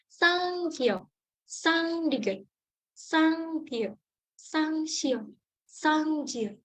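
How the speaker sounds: sang kiok, sang diget said with a high, tense, falling "sang" and hard stopped initials; teacher drilling the doubled letters with a sharp high "sang"; tense Korean doubled consonants. Shown as a demonstration. Teacher models the double consonants for students, tense and high. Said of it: "di awalnya kita tambahin sang".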